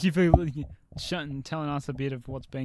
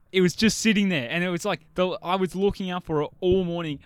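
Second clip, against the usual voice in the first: excited; 6 semitones higher, 5 dB louder than usual. Retold or imitated. Retelling finding the doll.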